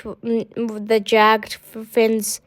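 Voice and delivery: ordinary delivery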